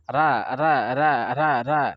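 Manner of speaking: ra diulang ringan disertai tawa; ra sambil tertawa kecil. Shown as a demonstration. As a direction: latihan ra santai sambil tertawa. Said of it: "enak nggak bergerak sama sekali". (ra datar diulang sambil tertawa)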